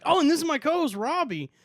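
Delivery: normal talking voice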